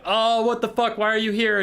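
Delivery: high, loud mock alarm; hammed-up shocked outburst; Thanquol. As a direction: exclaim like you've been startled by an unwelcome visitor. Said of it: "Thanquol's like".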